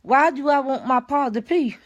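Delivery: ordinary talk, nothing marked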